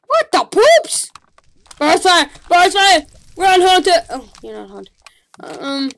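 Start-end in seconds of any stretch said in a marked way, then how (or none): 0.1-1.1 high, slow squeal of alarm; startled squeal. Real, not acted. Player startled by a butterfly, squealing. 1.8-4.0 loud, high alarmed shouting; panicked yell. Real, not acted. Player yelling at his character to run, panicked.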